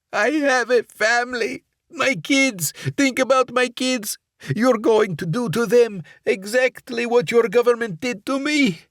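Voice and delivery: high, loud, frantic begging for his life; a hostage at gunpoint begs Barrett for his family, frantic; desperate pleading. Acted. Hostage at gunpoint pleading to spare him, high and frantic.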